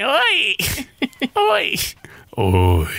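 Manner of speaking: a grand soap-star greeting, lightly done; Suzana Vieira impression. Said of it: "Ou senão a Suzana Vieira".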